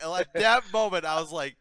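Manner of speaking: usual speaking voice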